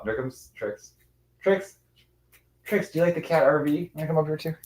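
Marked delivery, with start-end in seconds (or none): 1.4-4.5 high-pitched coaxing pet talk; calling a pet. Real, not acted. Owner inviting Trix to come up, high coaxing pet talk. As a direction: coax the cat over, pitched high.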